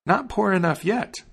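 high-pitched little bird retort; taunting sparrow. A narrator's reading. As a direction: answer back high, as the sparrow. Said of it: "answered the sparrow".

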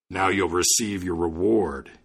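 slow, weighty giant voice; imposing spirit. Acted. Freed giant spirit rewarding the student, imposing. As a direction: pronounce it slowly from a great size.

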